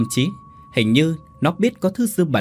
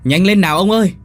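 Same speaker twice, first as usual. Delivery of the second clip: high, loud holler to hurry; yelling; 8 semitones higher, 8 dB louder, faster than usual. A narrator's reading. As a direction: yell out to a dawdling husband. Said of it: "bà Tần vẫn hét lên".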